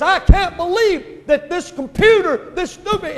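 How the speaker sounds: high, loud exasperated outburst; mad, frustrated. Acted. Secretary raging at her computer, frustrated and mad. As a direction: act out losing your temper, slow and incredulous. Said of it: "she gets mad".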